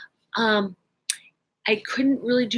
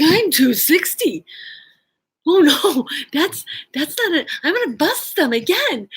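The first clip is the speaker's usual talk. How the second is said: higher, louder mock alarm; retold dismay; 7 semitones higher, 4 dB louder than usual. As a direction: exclaim it in comic panic. Retells her dismay at weight limit.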